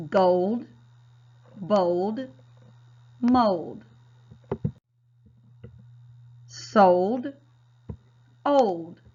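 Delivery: each word said loudly with a long O; modeled reading. Shown as a demonstration. Teacher models -old words loudly for the class. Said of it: "Read these with me".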